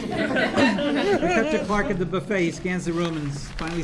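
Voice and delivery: narration broken up by a real laugh, slowed; laughing through it. Real, not acted. Narrator reading the table-read direction while laughing. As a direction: reader giggles while reading the stage direction.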